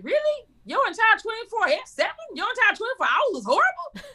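high-pitched mock disbelief; incredulous. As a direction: question it as wildly exaggerated. Mock disbelief at "bad day.

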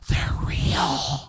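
forceful stage whisper; acted hushed amazement, all breath; awed whisper. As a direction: whisper it out loud in disbelief. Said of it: "I think I actually whispered it out loud".

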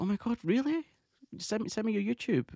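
enthusiastic. Retold or imitated. Emma hearing about the fan's podcast, enthusiastic. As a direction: gush it high as the keen idol. Voices idol's reaction to podcast news.

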